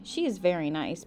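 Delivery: ordinary delivery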